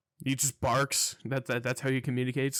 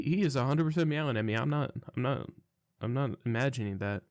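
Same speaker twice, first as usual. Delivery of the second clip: usual speaking voice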